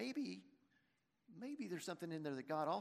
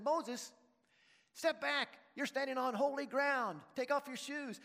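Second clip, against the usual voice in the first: raised, firm voice calling from the bush; God orders Moses off holy ground, firm and raised; commanding voice of God; 7 semitones higher, 3 dB louder than usual. Acted. God at the burning bush tells Moses to step back, firmly and raised. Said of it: "the bush then continued to talk and said".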